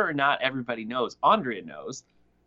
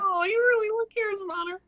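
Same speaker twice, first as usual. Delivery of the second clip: mocking baby voice. Mocking Steve again.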